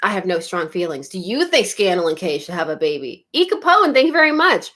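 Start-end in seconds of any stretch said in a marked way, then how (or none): none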